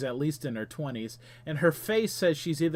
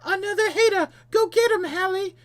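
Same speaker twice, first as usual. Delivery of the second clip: shrieky falsetto battle cry; hammed-up falsetto attack shout; high-pitched shout; 8 dB louder than usual. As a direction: yell it in a very high girlish voice. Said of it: "she shouts and lunges at you".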